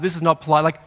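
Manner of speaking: ordinary talk, nothing marked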